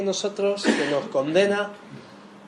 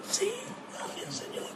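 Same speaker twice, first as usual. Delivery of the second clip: breathy whispered prayer, much softer; hushed grateful whisper to the Lord; whispered thanks; 13 dB quieter than usual. Pretend. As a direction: whisper your thanks to God under your breath. Silent prayer of relief.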